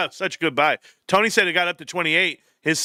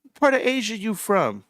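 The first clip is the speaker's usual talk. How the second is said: retold question; 7 semitones higher than usual. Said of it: "I was like".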